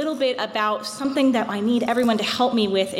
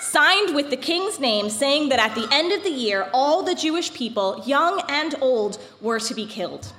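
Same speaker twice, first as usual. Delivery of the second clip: epic fantasy narrator. A narrator's reading. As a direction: tell it like an old legend. Reads the Purim story aloud.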